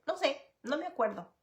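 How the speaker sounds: put-on a light, offhand kid's shrug in the voice; mock child brushing off the question; child's evasive reply. Son dodging mother's question.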